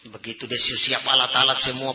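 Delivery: usual speaking voice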